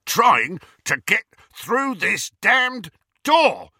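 high, loud and slow with effort; frustrated roar; angry shout. A narrator's reading. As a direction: shout in exasperation, punching each word. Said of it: "shouted Amycus".

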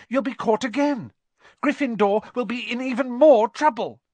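put-on high, pleading boy voice; anxious Neville. A narrator's reading. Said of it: "said Neville".

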